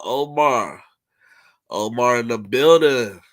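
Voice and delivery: hype shoutout. Real, not acted. Hyped greeting to a viewer.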